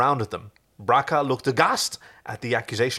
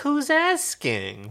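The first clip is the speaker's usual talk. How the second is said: high, drawn-out cheeky retort; 13 semitones higher, much slower than usual. Acted. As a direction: toss it back slowly and cheekily. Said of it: "responded Chiana cheekily".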